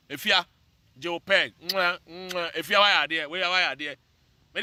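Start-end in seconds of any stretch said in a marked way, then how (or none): 1.7-2.5 two soft smacking air kisses; blown kisses. Real, not acted. Sending love to police officer friends, soft air kisses.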